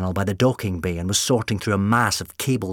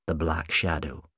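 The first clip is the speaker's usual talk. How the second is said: ordinary delivery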